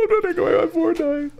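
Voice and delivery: put-on high whining child voice, pleading; whiny kid. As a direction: beg like a bratty kid. Kid pleading over lost game.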